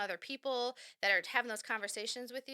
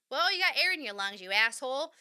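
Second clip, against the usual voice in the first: mock scold; 3 semitones higher, 8 dB louder than usual. Scolding the imagined grump.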